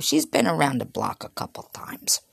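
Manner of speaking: soft confiding whisper. Acted. Father warning his son about Shirley, whispered. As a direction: drop to a whisper for the gossip.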